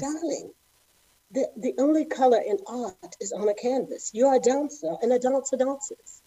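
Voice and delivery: a gentle hint of a British ballet master; British director. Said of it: "he's British, right?".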